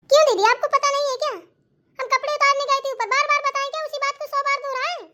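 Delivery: high, loud, indignant rush of words; fed up with explaining; exasperated protest. Acted. Exasperated at being questioned repeatedly.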